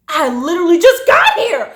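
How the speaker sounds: high, loud, incredulous outburst; indignant protest. Retold or imitated. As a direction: protest in disbelief at the boss. Retold protest at being sent home.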